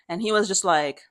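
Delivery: ordinary delivery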